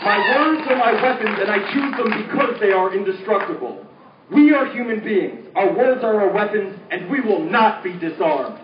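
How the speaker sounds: intense, rhythmic protest poem delivery; spoken word poem. Acted. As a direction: declaim it like a slam poet.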